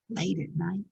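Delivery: secretive storyteller whisper. Shown as a demonstration. Storyteller whispering to children, hushed and secretive. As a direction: hush it like a secret. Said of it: "unvoiced whisper".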